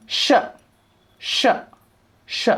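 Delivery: sh sounded out as a short "shuh"; teacher repeating sh as an isolated sound; digraph sound demo. Shown as a demonstration. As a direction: say sh as one blended syllable, spaced out. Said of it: "gives a sound".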